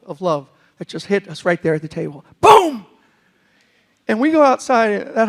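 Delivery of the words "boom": Boom! shouted high and loud. Retold or imitated. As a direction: punch out Boom! like a thunderclap. Loud boom after the quiet one.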